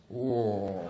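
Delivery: long, low contented groan; low pleasure moan. Retold or imitated. Brother gets his back massaged, low contented moan.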